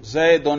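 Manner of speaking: زیدن ending in -un, zaidun; زیدن with the raf -un ending; nominative ending. Shown as a demonstration. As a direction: say زیدن as "zai-dun", with a u before the n. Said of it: "اس کے رفع پر ہم تلفظ کر رہے ہیں".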